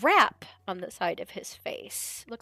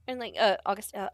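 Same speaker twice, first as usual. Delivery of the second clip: ordinary talk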